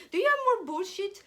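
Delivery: retold playful request; innocent asking. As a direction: request it keenly, with no idea it's rude. Retold innocent request during play.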